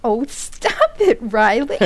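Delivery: giggling protest; playful laughing scold; flustered laughing. Acted. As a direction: laugh through it, pleased and embarrassed.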